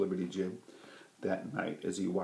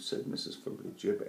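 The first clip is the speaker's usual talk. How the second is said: ordinary delivery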